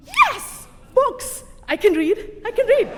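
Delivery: very high, loud squeals; hammed-up ecstatic outburst; overjoyed relief. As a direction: burst out as if rescued. Overjoyed at being asked to read.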